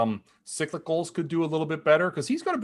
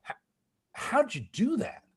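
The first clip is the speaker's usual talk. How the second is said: amazed question; 11 semitones higher, 4 dB quieter than usual. Retold or imitated. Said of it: "He looks at me and goes".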